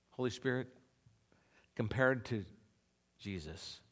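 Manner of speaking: hushed sincere prayer; humble prayer. Acted. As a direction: ask God quietly, humbly. Praying humbly to the Spirit.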